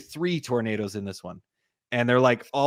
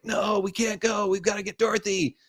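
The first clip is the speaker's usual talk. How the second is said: desperate protest; 8 semitones higher than usual. Retold or imitated. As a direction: imitate a heroine refusing to leave. Joe's desperate protest, voiced in retelling.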